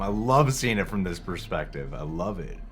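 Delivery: ordinary talk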